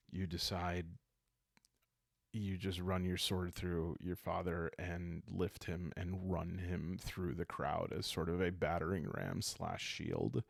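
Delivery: ordinary delivery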